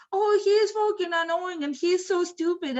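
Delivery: high, irritated complaint; acted-out high exasperation; exasperated venting. Friend acted out, venting annoyance.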